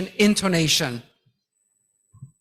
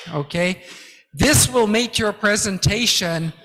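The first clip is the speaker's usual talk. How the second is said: ordinary talk, nothing marked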